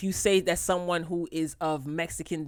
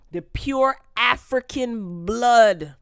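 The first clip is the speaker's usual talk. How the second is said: slow, higher and louder, each word weighted; emphatic proclamation; 4 semitones higher, 6 dB louder, much slower than usual. Real, not acted. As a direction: proclaim it slowly and loudly, drawing out the words. Mock-solemn declaration of heritage refusing topic.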